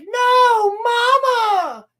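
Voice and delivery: high, loud crying wail for her mother; anguished cry. Retold or imitated. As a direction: sob the word out in panic. Girl sees room on fire.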